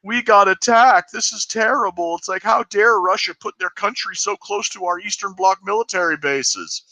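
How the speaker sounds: high, whiny, mock-outraged voice; mocking crybullies. Mocking hawks to his cohost as whiny crybullies. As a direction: play a shrill hawk crying victim. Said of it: "being like".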